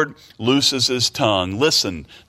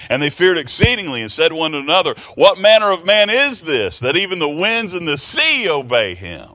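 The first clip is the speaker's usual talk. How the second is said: loud, high and fast, full of amazement; frightened disciples crying out in wonder at the calm; astonished disciples; 7 semitones higher, 4 dB louder than usual. Acted. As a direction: gasp it out in awe, loud and high.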